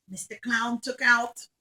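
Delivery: ordinary delivery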